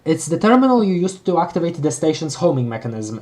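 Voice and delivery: usual speaking voice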